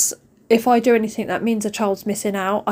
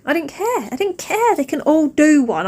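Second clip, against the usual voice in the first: higher, rising defiant anger; defiant rage; 6 semitones higher, 3 dB louder than usual. Real, not acted. Mum reliving screaming in the car, higher defiant anger. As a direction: spit it out, past caring.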